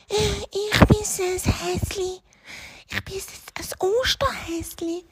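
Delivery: tiny, high, soft voice; timid little hare. Acted. Hare announces itself to the witch, timid and soft. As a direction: answer meekly, small and hesitant.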